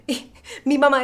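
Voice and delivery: ordinary delivery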